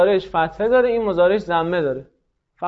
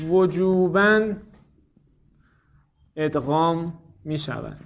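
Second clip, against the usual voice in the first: slow dictation; slower than usual. Real, not acted. Teacher dictates a grammar rule.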